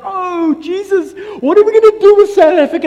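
very high, loud, fast mock panic; panicked God. Pastor mocking an anxious God about South Africa, high panicked voice. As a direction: fret in helpless alarm.

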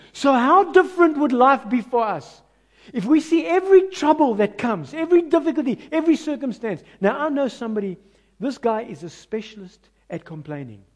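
ordinary talk, nothing marked

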